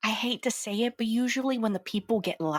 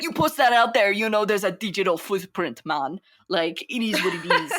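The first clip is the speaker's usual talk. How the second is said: put-on Jamaican accent; 4 dB louder than usual. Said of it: "I did a Jamaican accent".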